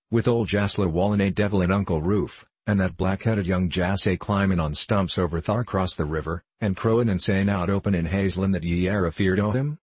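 usual speaking voice

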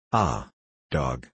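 low, biting curse; a dark, furious exclamation; angry outburst. A narrator's reading. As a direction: spit the insult with rage. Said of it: "shouted Renzo".

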